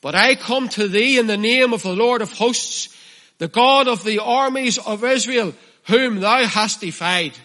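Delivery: David answering Goliath in the valley, bold and ringing; David's bold reply. Acted. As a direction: stand firm and proclaim it. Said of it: "listen, and this is the key".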